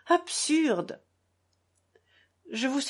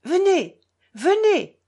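commanding call, higher and louder; imperious; 8 semitones higher, 7 dB louder, slower than usual. A narrator's reading. Lise through the door, commanding Alyosha to come. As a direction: order him in at once. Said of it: "d'une voix impérieuse".

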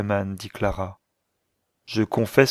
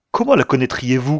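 louder, irritated challenge; 3 semitones higher, 6 dB louder than usual. A narrator's reading. Will challenging Clara's wish to meet Mary, irritated. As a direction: throw the question back crossly. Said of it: "sa voix était si irritée".

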